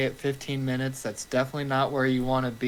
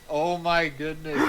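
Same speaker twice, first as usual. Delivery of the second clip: ordinary talk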